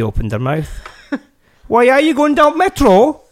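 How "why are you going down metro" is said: high, loud-ish attempt at Geordie; badly put-on Newcastle accent, pitched up. Storyteller imitating Newcastle neds on the metro, bad Geordie accent. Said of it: "I can't do the accent".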